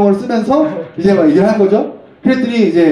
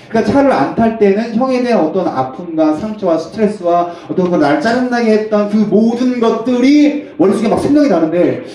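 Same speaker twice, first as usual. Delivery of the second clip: usual speaking voice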